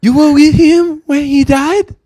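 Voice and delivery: put-on high, loud, slow Japanese-accented question; exaggerated Japanese film-character accent. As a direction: ask solemnly in a hammed-up Japanese accent.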